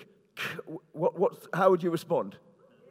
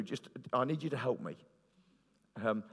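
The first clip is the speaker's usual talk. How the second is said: ordinary delivery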